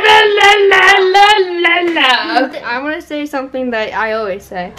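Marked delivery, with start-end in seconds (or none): 0.0-2.4 loud furious la la la; angry gibberish shout. Pretend. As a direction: yell nonsense syllables in a rage. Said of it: "start talking Korean, like, really angrily".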